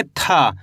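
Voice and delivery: था with dental t and a puff; letter-sound demo. Shown as a demonstration. Teacher shows how to read it, letter-sound demo. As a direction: draw out aspirated था, dropping low. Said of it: "इसको अपन कैसे पढ़ेंगे?".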